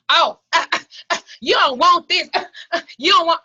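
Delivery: laughing loud and high; cracking up at her own story. Real, not acted.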